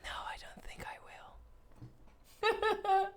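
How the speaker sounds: ASMR whisper for the stream. Acted. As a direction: whisper close and intimate like an ASMR video.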